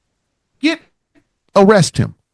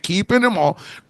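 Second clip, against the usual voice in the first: usual speaking voice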